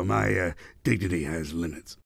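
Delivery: low, soft, trailing remark; grumbled aside. Acted. Villain refuses an insulting nickname, grumbling low. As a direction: drop it low under your breath.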